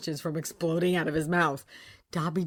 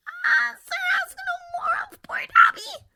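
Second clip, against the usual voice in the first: very high, loud tearful plea; a frightened elf begging; stammering elf; 8 dB louder, slower than usual. A narrator's reading. Dobby begging Harry to stop asking, high and frightened. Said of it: "stammered the elf".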